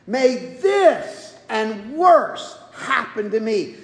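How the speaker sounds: slow, weighty vow; grave slow curse on oneself; solemn oath. Acted. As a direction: let each word of the oath land slowly.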